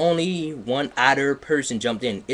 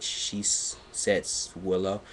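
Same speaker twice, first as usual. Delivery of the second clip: breathy stumbling; 3 semitones lower, 5 dB quieter than usual. Real, not acted.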